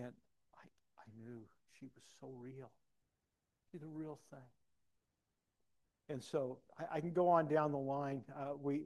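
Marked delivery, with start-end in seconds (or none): 0.6-4.4 very soft, nearly whispered reverence; quiet heartfelt confiding; hushed, moved. Real, not acted. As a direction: let the voice drop away in awe. Moved while recalling fiancee's faith.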